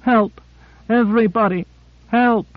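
high-pitched, restrained scream for help; shrill scream. A narrator's reading. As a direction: cry out shrilly without full volume. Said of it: "she screamed as shrilly as she could".

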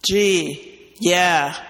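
ordinary delivery

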